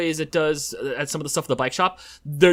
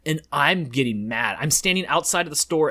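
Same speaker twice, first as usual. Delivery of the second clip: normal talking voice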